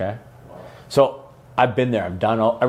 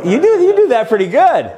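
laughing; 12 semitones higher, 6 dB louder than usual. Real, not acted. Laughing at the accent imitation.

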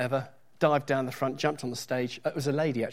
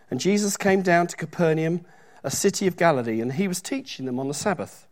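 louder, declaiming Bible reading; scripture reading; 3 semitones higher, 4 dB louder than usual. Acted. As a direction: read the verse out to the congregation. Reads scripture passage aloud.

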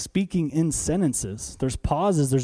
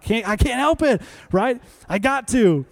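higher-pitched, hammed-up helpless plea; mock helplessness; 6 semitones higher than usual. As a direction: plead a pretend uncontrollable urge. Acts out uncontrollable urge in Walmart.

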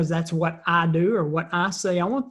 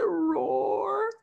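very high, feeble lion roar; small whimpering mock roar; crying child roaring; 14 semitones higher, 4 dB quieter than usual. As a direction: imitate a tearful little boy's roar. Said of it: "he's kinda crying".

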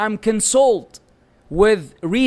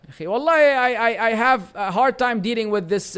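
normal talking voice

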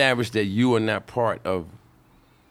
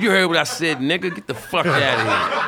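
loud, high mock heckler yelling back; 6 semitones higher, 5 dB louder than usual. Heckler telling the comic on stage to get out, yelling. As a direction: shout it down from the seats like a rowdy heckler. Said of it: "He said".

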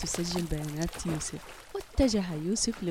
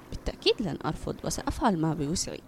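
fast, eager, half-breathed reply; immediate answer; 5 semitones lower, faster than usual. Acted. Mother promising her son at once, fast. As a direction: answer straight away, fast. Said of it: "أجابت فورا".

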